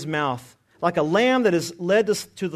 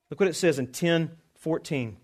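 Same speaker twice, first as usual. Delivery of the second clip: normal talking voice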